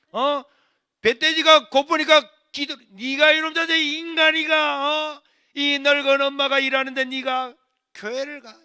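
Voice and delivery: loud, high, drawn-out berating; scolding mother. Retold or imitated. Old working mother berating churchgoing son, angry shouting. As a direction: rant at him at full voice. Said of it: "야단치니까".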